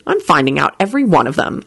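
plain reading voice

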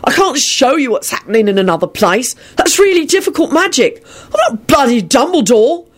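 high, exasperated angry protest; furious retort. A narrator's reading. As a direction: defend yourself furiously. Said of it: "William yelled back furiously".

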